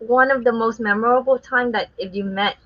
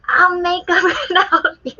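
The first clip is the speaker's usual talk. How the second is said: mock karaoke yell, higher and laughing; retold drunken yell; 8 semitones higher than usual. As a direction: belt it half-laughing like a drunk karaoke singer. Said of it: "I was screaming that in the club".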